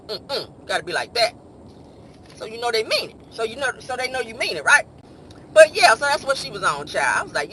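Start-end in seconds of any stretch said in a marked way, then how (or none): none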